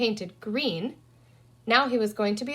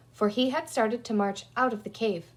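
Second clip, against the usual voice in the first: ordinary delivery, nothing marked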